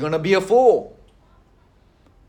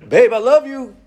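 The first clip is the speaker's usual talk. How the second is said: playful, higher, laughing into it; loving soft words; 5 semitones higher than usual. Retold or imitated. Husband copying wife's soothing, playfully tender. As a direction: turn her own trick back on her. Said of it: "So then I started doing it to her".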